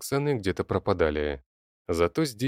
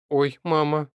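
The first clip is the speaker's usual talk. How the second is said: flustered; 8 semitones higher, 4 dB louder, slower than usual. Acted. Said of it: "смутилась Алёна".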